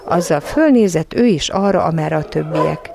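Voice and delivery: plain reading voice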